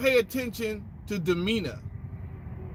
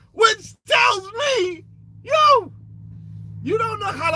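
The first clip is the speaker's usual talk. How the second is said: excited; 10 semitones higher, 3 dB louder than usual. Real, not acted. Excited punchline after laugh.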